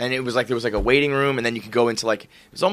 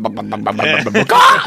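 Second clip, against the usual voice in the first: revved-up chicken squawk; 4 semitones lower than usual. Retold or imitated. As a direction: wind up the clucks, then let the bakaw rip. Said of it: "like, a revved up".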